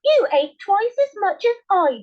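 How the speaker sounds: the wife accuses her husband; indignant wife. A narrator's reading. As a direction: blame him right back.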